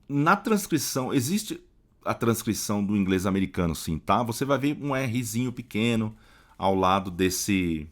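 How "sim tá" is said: ordinary talk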